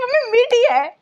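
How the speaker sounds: high, whining refusal, slow at the end; a sulky kid refusing the medicine; whiny child. Retold or imitated. Mom imitating her daughter refusing the tonic, whiny and slow. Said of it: "यूँ कहती थी".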